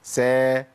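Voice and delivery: "سا" with a thin s and fronted vowel; open, light seen. Shown as a demonstration. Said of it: "عرفتوا الفرق بين الإطباق والانفتاح؟".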